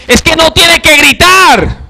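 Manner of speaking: high, loud, slow mock shout at a child; parent yelling. Parent shouting at child not to shout, mock yell. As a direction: yell the order not to yell, hammed up. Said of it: "entonces usted le está gritando".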